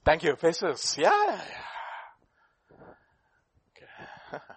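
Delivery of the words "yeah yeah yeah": ordinary delivery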